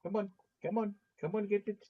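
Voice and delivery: coaxing pet. Real, not acted.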